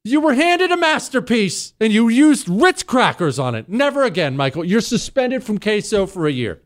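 a host mock-chewing out his producer for ruining the queso; mock outraged scolding. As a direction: scold him like a betrayed chef. Scolding Michael over Ritz crackers.

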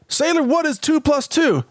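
high mock drill officer voice; mock navy officer. Host plays an officer testing a recruit in a high mock voice. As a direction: quiz the sailor like a cartoon officer.

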